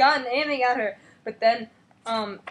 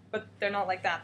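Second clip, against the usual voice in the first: ordinary talk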